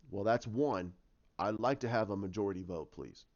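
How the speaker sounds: low, dry reply, dropping away; Batman. Retold or imitated. Answering a plea to rejoin the team, low and dry. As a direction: answer low, fading on the last word.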